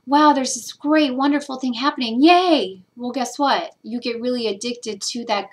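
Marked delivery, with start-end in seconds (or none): 0.0-2.8 high, loud gushing delight over a good feeling; mock excited. Mocking someone thrilled by a good feeling, high and loud. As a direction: squeal it like a thrilled child.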